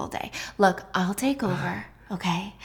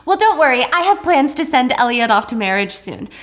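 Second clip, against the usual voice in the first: loud; 4 semitones higher, 5 dB louder than usual. Acted. Grandmother announces marriage plans.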